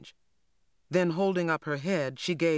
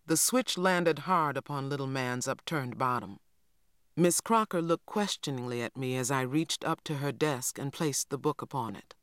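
ordinary delivery

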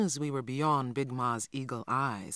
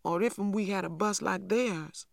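put-on frowning little boy; 5 semitones higher than usual. A narrator's reading. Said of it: "he frowned deeply and added".

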